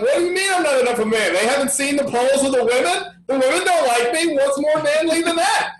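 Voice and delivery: high, fast, outraged disbelief; mock indignant politician. Candidate scoffing at men's poll, indignant and fast. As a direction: sputter like an offended candidate who can't believe it. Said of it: "You're like".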